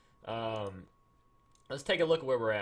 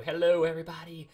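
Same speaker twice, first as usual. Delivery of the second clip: showy greeting; 3 semitones higher, 6 dB louder, faster than usual. Real, not acted. Streamer answering people saying hello, showy greeting. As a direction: greet the whole chat loudly.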